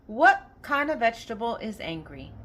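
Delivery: ordinary talk, nothing marked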